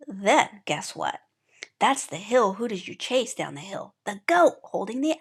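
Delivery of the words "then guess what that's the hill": ordinary delivery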